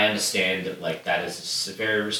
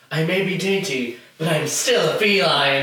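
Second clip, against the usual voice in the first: lifted and louder, a lightly played dainty cat; prissy cat line; 5 semitones higher, 4 dB louder than usual. Reading a favourite film line aloud to a co-host, lightly mock-dainty. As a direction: toss the line out like a proud little cat.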